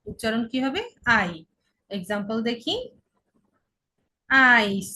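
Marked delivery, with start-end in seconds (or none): 4.3-4.9 "আইস" with a long, loud "আই". Shown as a demonstration. Teacher giving an ei example, drawn-out "আই". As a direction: stretch "আই" and close on s. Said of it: "ইআই এর উচ্চারণ আই".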